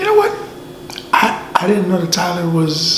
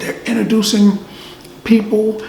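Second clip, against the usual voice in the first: normal talking voice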